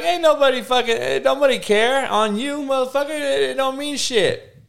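mock the crowd dismissing the oath. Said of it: "everyone was like".

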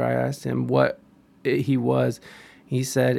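normal talking voice

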